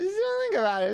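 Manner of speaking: tiny high-pitched reassurance; high squeaky voice. Acted. Sudden high put-on voice.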